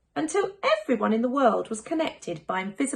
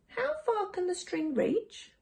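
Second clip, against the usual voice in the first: put-on higher, softer child voice; a small child wondering; soft child question; 10 semitones higher, 4 dB quieter than usual. Child character voice.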